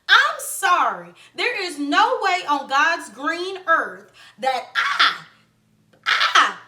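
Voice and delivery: theatrical protest at giving up a phone; mock outrage. Mock outrage at losing her phone.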